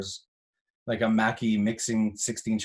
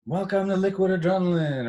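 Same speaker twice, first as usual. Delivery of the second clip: MC hype voice; 9 semitones higher than usual. Acted. Recording an MC drop.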